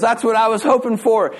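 high-pitched, delighted relief; thrilled, lifted reaction; acted elation. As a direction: celebrate it, voice riding high. Friend celebrates baseball in heaven.

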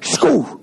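high, sudden "shoo"; imitated ball whizzing past. Kid up there batting, high whizzing noise. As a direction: make the sound of a fast pitch flying by.

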